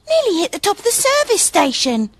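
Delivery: high teasing fairy voice; Lily teasing. Acted. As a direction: tease him, pitched high. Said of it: "added Lily".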